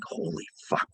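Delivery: breathy awed hush, high up; stunned whisper. Retold or imitated. Telling the stream about the ugly dog, whispered shock. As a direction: whisper it in disbelief.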